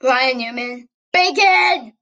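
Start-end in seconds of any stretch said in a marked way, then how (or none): 1.1-1.9 excited shout. Acted. Joking exclamation about sponsor.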